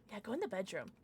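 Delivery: ordinary talk, nothing marked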